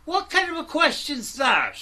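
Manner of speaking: he is fed up and lets it rip; exasperated outburst. Real, not acted. Host snapping back at the questioner, loud and exasperated. Angry reply to a livestream question.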